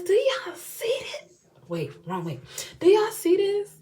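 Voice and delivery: very high, squealing with delight; thrilled squeal. Real, not acted. Showing viewers the new lashes, a high thrilled squeal. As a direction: show it off like you can't believe it.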